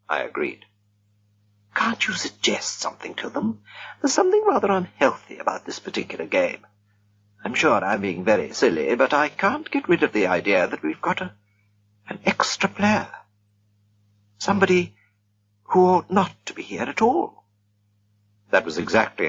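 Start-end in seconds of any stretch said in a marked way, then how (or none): 1.7-3.6 hushed, breathy whisper. A narrator's reading. Lady whispers to Tony in the dark, hushed whisper. 4.0-17.4 a genteel woman's voice pitched high and light; lightly voiced lady. A narrator's reading. Lady chats to Smee and Tony in the dark, lightly voiced high woman. Mrs Gorman voiced, uneasy.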